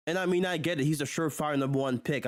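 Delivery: ordinary talk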